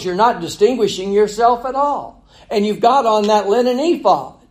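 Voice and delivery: high, loud reproach as the king's wife; sarcastic scolding. Acted. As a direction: scold the king for looking common. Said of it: "You know what she's saying?".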